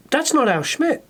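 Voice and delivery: high-pitched mock surprise; puzzled disbelief. Man retelling hearing the wrong winner announced, mock bafflement. Acting out his puzzled reaction.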